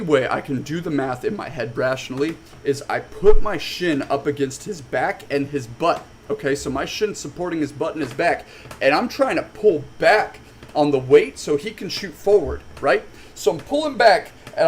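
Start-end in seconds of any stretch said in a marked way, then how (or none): none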